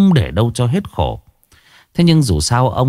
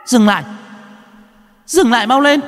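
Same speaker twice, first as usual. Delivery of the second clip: put-on shrill child shout; 14 semitones higher than usual. Ghost child shouting at the driver, shrill and high. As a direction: yell like a ghost child demanding a stop. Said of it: "tiếng trẻ con quát lên the thé".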